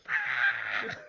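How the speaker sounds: high, loud squeal of joy; delighted high-pitched shriek; excited shriek. Retold or imitated. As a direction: squeal like a fangirl. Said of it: "I was just like".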